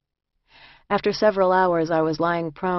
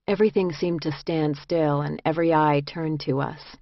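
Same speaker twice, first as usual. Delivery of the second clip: ordinary delivery, nothing marked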